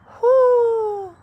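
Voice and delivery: long, very high, loud hoot; owl hoot. Acted. Owl hooting down at the bear, very high loud hoot. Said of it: "and hooted".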